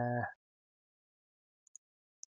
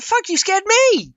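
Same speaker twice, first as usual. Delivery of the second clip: high, loud and slow startled cry; scared outburst; 8 dB louder, slower than usual. Retold or imitated. Recalling the shop scare for viewers: high frightened cry. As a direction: let the fright burst out, drawn out. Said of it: "I was like".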